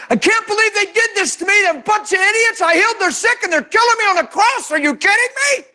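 high, mock-outraged whining; hammed-up griping about the cross; indignant complaint. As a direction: play a petty, offended martyr. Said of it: "That sounds a little different than".

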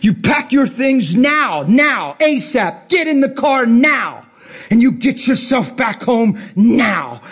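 acted-out shouted ultimatum; retold screaming. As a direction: bark urgent commands down the phone, drawn out. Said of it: "And I'm screaming".

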